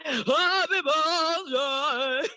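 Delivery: high, very drawn-out birthday greeting; mock a birthday-video voice, stretched out long; Brooke Porch. Mimicking a friend's birthday videos, exaggerated drawn-out high voice. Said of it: "have you ever seen her birthday videos?".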